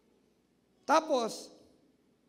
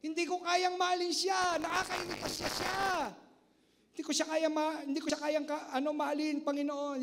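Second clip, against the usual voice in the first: a hint of a frustrated believer, higher; lightly voiced plea for guidance; mild pleading prayer; 5 semitones higher than usual. Believer asking God for help loving an irritating person, lightly pleading. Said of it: "Hingin mo ang patnubay".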